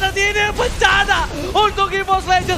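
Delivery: excited shouting. Real, not acted. Teamfight climax, caster screaming.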